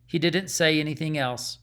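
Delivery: ordinary delivery, nothing marked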